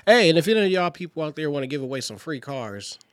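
booming hype shout; loud shout. Real, not acted. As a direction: yell it to the crowd.

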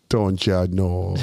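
slow mock Minnesota "Don't ya know"; Minnesota accent. Joking with friends in a slow Minnesota accent. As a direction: drawl it out like a Minnesotan. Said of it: "That accent smells like pancakes".

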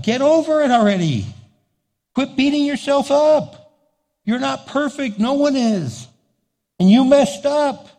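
high, forceful preacher's rebuke; exasperated scolding. Acted. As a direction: cut through the self-pity, pitched well up.

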